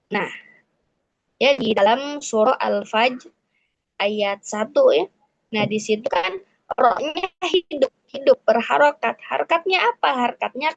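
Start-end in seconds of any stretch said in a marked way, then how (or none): none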